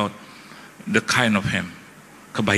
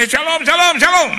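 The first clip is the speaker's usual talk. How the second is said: high, loud shouted greeting; shouting into the phone; 7 dB louder than usual. Retold or imitated. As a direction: yell shalom down a bad phone line. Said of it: "Pak Pendeta nggak usah teriak".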